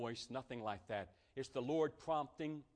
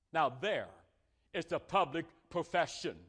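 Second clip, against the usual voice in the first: usual speaking voice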